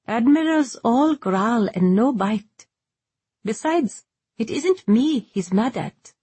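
light, high dialogue voice; Ryber, young sailor. Acted. Character dialogue inside narration.

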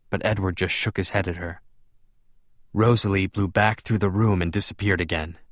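ordinary delivery, nothing marked

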